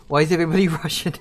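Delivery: laughing it out, quick and loud; amused disbelief breaking into a laugh; laughing question. Real, not acted. Player laughing at the game's accents, asks quickly and loudly.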